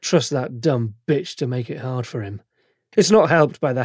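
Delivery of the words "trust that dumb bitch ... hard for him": ordinary delivery, nothing marked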